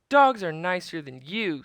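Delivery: put-on girl sassing the pirate captain; girl's retort. A narrator's reading. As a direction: talk back boldly. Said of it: "said Annie".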